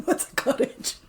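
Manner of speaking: hushed, secretive mock question; a hint of a sneaky servant playing dumb, whispered. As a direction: whisper it like a servant hiding something. Mocking the evasive housekeeper.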